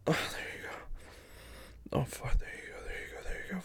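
soft, breathless whisper; whispered encouragement. Acted. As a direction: urge gently under the breath.

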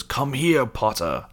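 high start dropping at the end; imitated villain beckoning; villain summoning. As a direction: order the boy over theatrically. Quirrell summons Harry.

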